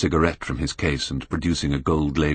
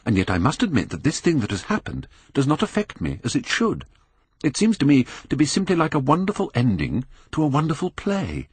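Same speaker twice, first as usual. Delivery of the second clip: put-on high, agitated young man; a young man wrestling with himself; 10 semitones higher than usual. A narrator's reading. Dorian voiced.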